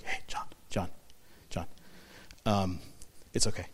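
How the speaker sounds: an elder whispers to the weeping John, hushed; soft whisper. Retold or imitated. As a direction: whisper to him gently so he stops crying.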